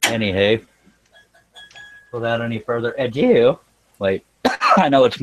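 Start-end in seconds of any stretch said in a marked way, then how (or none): none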